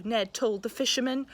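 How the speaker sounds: lively, expressive picture-book read-aloud; reading to children. A narrator's reading. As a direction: read it like a bedtime story for a class.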